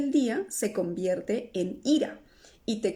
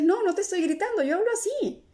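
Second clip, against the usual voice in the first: defensive denial; 10 semitones higher, faster than usual. Retold or imitated. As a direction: snap back that you're not shouting. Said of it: "tú dices".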